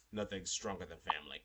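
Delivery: low mock-solemn family motto; movie-hero imitation. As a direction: drop deep for a film catchphrase. Mimicking Fast and Furious catchphrase.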